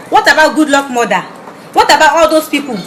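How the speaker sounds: ordinary delivery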